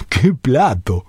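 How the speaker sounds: slow words through a chuckle; a fond slow chuckle; laughing fondly. Real, not acted. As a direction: laugh at the sweet memory. Remembering a great fight fondly.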